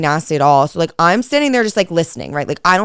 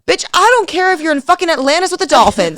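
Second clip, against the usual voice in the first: mock exasperated retort; 10 semitones higher than usual. Telling off the crying girl, high and exasperated. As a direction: fire back at her in a high, fed-up voice. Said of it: "I'm like".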